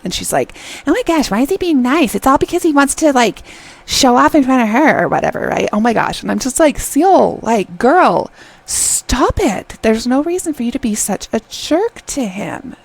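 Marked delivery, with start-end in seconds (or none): 0.9-5.5 high, huffy imitation of a doubter; critical, suspicious. Seol doubting his niceness in her head, mock suspicious. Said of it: "so critical and so suspicious". 6.8-12.8 high, emphatic viewer's telling-off; exasperated scolding. Retold or imitated. Viewer telling Seol to be kinder, exasperated scolding.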